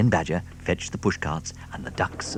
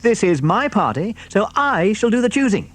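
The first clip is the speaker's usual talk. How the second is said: high, firm fox voice; commanding fox; 13 semitones higher, 6 dB louder than usual. A narrator's reading. Mister Fox to the hungry animals, high firm voice. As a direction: lay down the rules. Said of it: "ordered Mister Fox".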